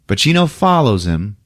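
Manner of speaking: ordinary delivery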